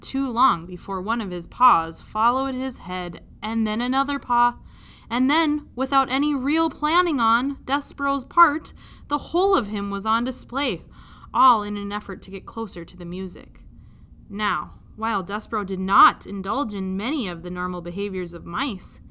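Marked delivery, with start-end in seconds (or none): none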